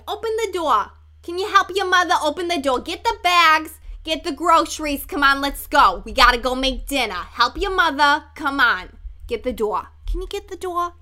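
loud, bossy New Jersey mom; hammed-up Jersey accent, hurrying the kids along. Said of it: "I took that for inspiration".

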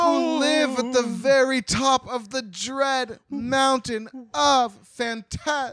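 very high, loud hammed-up shout; booming dramatic proclamation. Storyteller naming the dread mountain, booming dramatic proclamation. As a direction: proclaim it like a grand, dreadful reveal. Hammed-up ominous reveal of villains.

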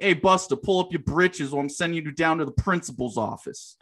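a hint of a 1930s New York tough-guy teacher; 1930s gangster accent. Teacher scolding a sagging student in a 1930s gangster accent. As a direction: lightly do the old-timey gangster scold. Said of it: "I'd be like".

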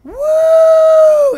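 a huge high loud woo; whooping shout. Retold or imitated. Tripping friend whooping inside the house, shouting. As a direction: whoop at the top of your lungs. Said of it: "we hear him going".

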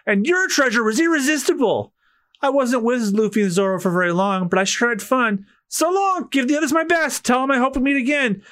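a hint of a carefree goodbye in character; breezy departing thief.

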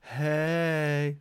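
cheery response. Shown as a demonstration. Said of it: "my students have to respond with".

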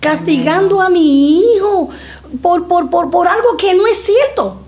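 retold indignant confrontation. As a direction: confront the teacher as a protective mother.

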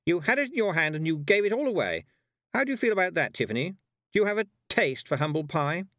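high, loud scornful taunt; haughty, cutting interrogation; sharp voice. A narrator's reading. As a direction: needle her with sharp disbelief. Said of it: "said a sharp voice behind her".

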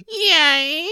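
high, loud creaking squeak; drawn-out, high-pitched Jamaican creak; squeaky door impression. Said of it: "Squeaky door in Jamaica".

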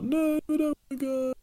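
put-on high, whining little-kid protest; child's high-pitched no; whiny child. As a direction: whine it like a small child refusing. Child refusing to leave home.

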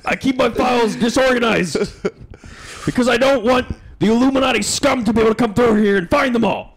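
Alex Jones impression. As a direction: rant it like a paranoid radio host. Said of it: "you're damn right it is".

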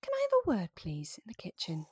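put-on a mother calmly summoning a misbehaving child; polite on top, threat underneath; mum's summons. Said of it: "but she'd go".

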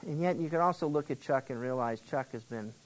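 soft, close, confiding preacher voice; intimate sermon aside. Acted. Preacher confiding softly to the congregation about Chuck Smith. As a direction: lean in and confide quietly.

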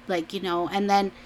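normal talking voice

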